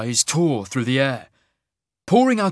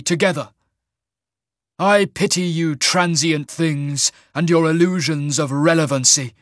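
higher, slow, ringing declamation; imperious immortal general; 5 semitones higher, 5 dB louder than usual. A narrator's reading. General scorns transient things, imperious and slow. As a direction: pronounce it grandly and slowly, looking down on mortals.

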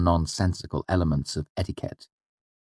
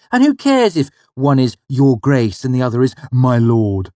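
protesting; 7 semitones higher, 7 dB louder than usual. Acted. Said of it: "I protested".

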